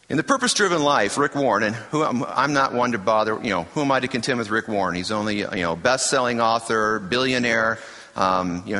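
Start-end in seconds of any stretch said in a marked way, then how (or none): none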